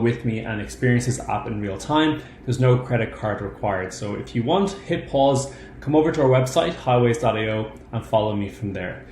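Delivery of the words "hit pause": usual speaking voice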